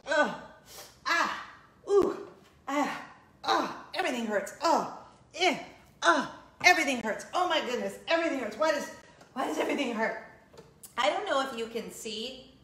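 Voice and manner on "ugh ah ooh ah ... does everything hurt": groaning and moaning while getting up; old lady groans. Shown as a demonstration. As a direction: ugh and ah with each stiff move, everything hurts. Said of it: "get up and show you".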